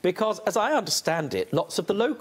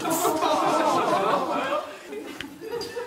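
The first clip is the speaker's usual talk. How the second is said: high laugh. Real, not acted. Judge laughs.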